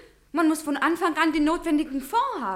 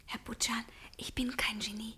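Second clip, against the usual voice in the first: soft breathy whisper, almost no voice; whispered confession; 5 semitones lower, 12 dB quieter than usual. Acted. Magda confiding to Butschan that she loves, in a whisper. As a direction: hush it like a secret admission.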